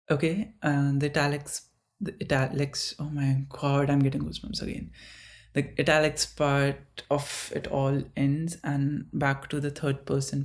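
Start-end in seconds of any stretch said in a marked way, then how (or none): none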